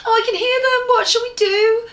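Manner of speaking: high, loud panicky cry; frightened. Acted. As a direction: squeal it out in a panic. Franny frightened by goblins.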